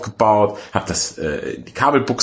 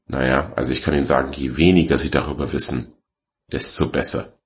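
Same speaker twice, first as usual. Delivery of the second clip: low, matter-of-fact tradesman voice; imitated technician, much lower; dour technician; 7 semitones lower than usual. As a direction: drop pitch and advise cryptically. Technician voiced in retold exchange.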